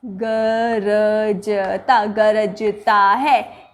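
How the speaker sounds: गरजता stretched very slowly and low; slow low गरजता, marked as not right; drawn-out wrong take. Shown as a demonstration. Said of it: "ये नहीं".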